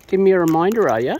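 loud, playful call to the bird; loud teasing. Real, not acted. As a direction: tease the bird loudly. Bird nagging for food.

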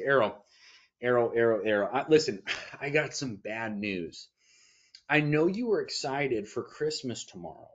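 mock-grave daddy voice, dragged out; somber bad news. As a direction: break the bad news gently to a child. Dad breaking bad news to son.

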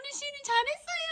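quick high-pitched praise; high praising. Real, not acted. Praising baby, repeated praise.

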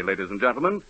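ordinary talk, nothing marked

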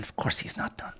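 breathy stage whisper. Shown as a demonstration. Teacher whispering the point to students. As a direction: hush it like a secret.

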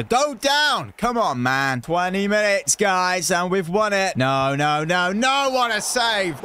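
ordinary talk, nothing marked

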